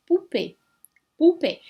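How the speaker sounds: "Poupée" with "ou" as a single oo sound; French vowel pair as one. Shown as a demonstration. Teacher modelling French poupée for learners, vowels merged. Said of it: "eu pronuncio como se fossem uma só".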